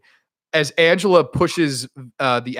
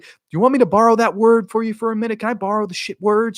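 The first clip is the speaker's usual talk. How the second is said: quick, higher-pitched needling questions; mock taunting; 8 semitones higher, faster than usual. Killer taunting the counselor at the porta potty, high quick mock taunt. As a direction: imitate a killer toying with her victim. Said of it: "she's taunting her".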